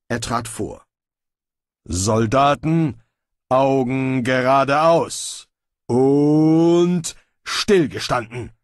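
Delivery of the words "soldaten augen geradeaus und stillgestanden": loud, drawn-out drill order; military command. Acted. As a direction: call the troops to attention, stretching every word. Bane drills the guards as soldiers. (militärischer Kommandoton)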